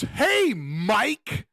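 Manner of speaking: mock the cop's pushy buddy-buddy hello; hammed-up chummy greeting, rising on Mike; overly familiar greeting. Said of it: "it's super overly familiar".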